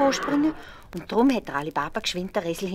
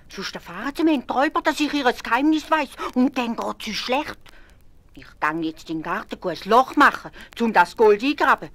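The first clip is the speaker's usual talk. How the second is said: Ali Baba warning; 7 semitones higher, 8 dB louder than usual. A narrator's reading. Ali Baba instructs wife.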